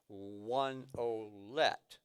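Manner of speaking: "one olet" drawn out very slowly; very slow count. Shown as a demonstration. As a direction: stretch out "one olet" beat by beat. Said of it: "I'll play it really slow so you can hear it".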